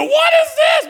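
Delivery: put-on high, loud childish protest; a son outraged at broccoli; high mock kid outburst; imitated whiny kid. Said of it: "He go".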